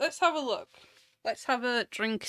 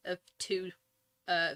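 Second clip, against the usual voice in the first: ordinary delivery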